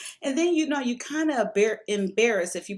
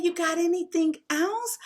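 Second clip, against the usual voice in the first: imitating a Mexican man's broken English, much higher voice; broken English Mexican accent; 10 semitones higher than usual. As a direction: put on the Mexican guy's accent, high and eager. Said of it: "he's talking to me in his broken English".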